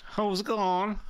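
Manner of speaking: badly faked Cork greeting; Chris Kent. Co-host imitating a Cork comedian for laughs, bad Cork accent. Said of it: "That's a bad Chris Kent impression".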